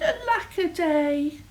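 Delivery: high, theatrical lament; hammed-up woe; plaintive cry. A narrator's reading. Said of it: "And he cried".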